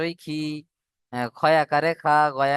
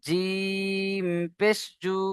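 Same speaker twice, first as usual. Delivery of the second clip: very slow, higher, softer chant on পেশ; slow recitation chant; drawn-out recitation; 4 semitones higher, 4 dB quieter, much slower than usual. Shown as a demonstration. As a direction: hold পেশ long like a chant. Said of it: "এইভাবে প্রত্যেকেই পড়বেন".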